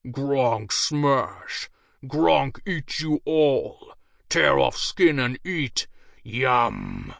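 slow, dumb brute threatening to eat them; roaring ogre. A narrator's reading. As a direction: growl it out like a hungry ogre. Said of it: "The beast pounded its chest and roared".

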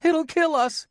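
put-on very high little-boy voice; frightened warning. A narrator's reading. Tom warning his sister about the ghost, frightened. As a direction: warn in a high, scared pitch. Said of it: "he warned".

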